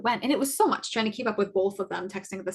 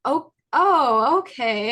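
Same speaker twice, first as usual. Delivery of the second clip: high, louder flustered "oh, oh" with a nervous laugh; awkward laugh; 3 semitones higher, 7 dB louder, much slower than usual. Retold or imitated. Student flustered by a professor's crude joke, nervous laugh. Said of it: "And I'm like".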